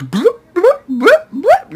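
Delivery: high, loud beeping register sounds; register beep noise. Retold or imitated. Cashier at Walmart scanning milk, high beeping noises. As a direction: chirp it like a scanner. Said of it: "making that stupid register noise".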